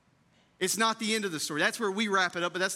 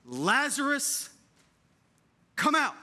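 commanding call; 6 semitones higher, slower than usual. Acted. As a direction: call him out of the grave with authority. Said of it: "with command and authority".

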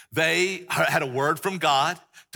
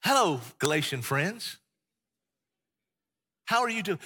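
mild Mister Rogers; 2 semitones higher than usual. Retold or imitated. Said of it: "Paul is not Mister Rogers".

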